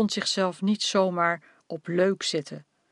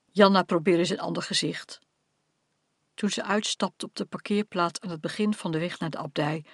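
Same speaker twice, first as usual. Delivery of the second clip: ordinary delivery, nothing marked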